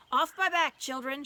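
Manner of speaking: loud, high roaring command; a lion leaping into battle throws off riders; shout. A narrator's reading. Aslan shouting to the children on his back, loud command. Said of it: "shouted Aslan".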